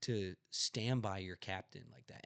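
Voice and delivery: retold anger, hot at first then settling; fuming rant. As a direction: tell him off as if still furious. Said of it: "I'm, I'm fuming".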